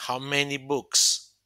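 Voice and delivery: How many books said slowly and clearly; slow English example. Shown as a demonstration. Teacher reading the English example slowly to students. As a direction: spell the question out for learners. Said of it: "Por ejemplo, ¿cuántos libros hay?".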